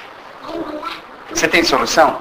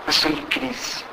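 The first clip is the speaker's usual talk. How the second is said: sussurro de crise; 4 semitones higher, 11 dB quieter than usual. Retold or imitated. Imitando alguém em crise.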